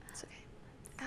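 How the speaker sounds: whispered aside. Real, not acted. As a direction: breathe it under your breath. Muttered aside.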